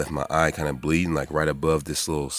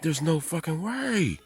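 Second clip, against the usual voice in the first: disbelief; 13 semitones higher, slower than usual. Retold or imitated. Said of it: "I fucking lost it".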